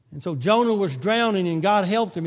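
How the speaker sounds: usual speaking voice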